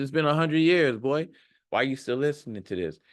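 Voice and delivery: normal talking voice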